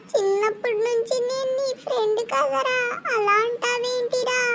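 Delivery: playing the wounded childhood friend; whiny mock hurt.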